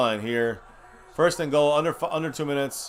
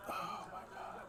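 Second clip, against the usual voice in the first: breathy, barely voiced oh my God; despairing whisper; 22 dB quieter than usual. Real, not acted. Fan whispering in despair at his team. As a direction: whisper it in disbelief.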